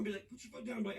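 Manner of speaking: ordinary talk, nothing marked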